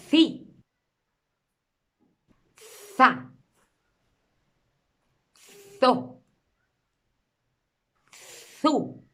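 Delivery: each syllable "Ci", "Za", "Zo", "Zu" a blown th then a short vowel; th puis voyelle. Shown as a demonstration. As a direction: model isolated th syllables, vowel popped. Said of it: "Uno, soplar".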